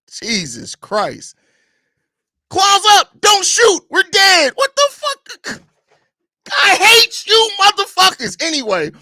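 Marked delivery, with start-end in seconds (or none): 2.5-8.4 high, loud, outraged shout; cartoonishly furious yelling voice; exasperated yell voice. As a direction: scream it like you can't believe what you're seeing. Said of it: "I read it all the time in that voice".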